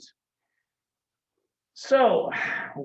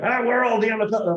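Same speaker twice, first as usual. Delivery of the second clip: Madden insisting; 7 semitones higher, 5 dB louder, faster than usual. Retold or imitated. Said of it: "And it's like".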